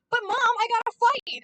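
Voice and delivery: put-on high, loud pleading child; imitating a pleading child; whiny kid. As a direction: beg mom to let you fight.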